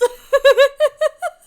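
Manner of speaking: loud, high peal of laughter. Real, not acted. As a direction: crack up at your own bad accent. Laughing at own attempt.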